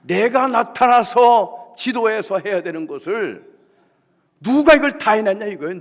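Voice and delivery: shouted high and loud, slow, as the enraged chairwoman; furious outburst. Retold or imitated. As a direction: roar it out like she blew her lid. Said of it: "뚜껑 열린 겁니다". (화난 회장님의 분노 어린 고함)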